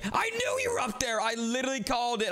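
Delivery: high-pitched cry of vindication; excited, high exclamation; excited outburst. Real, not acted. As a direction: burst out high as you spot them. Spots hidden player, exclaims.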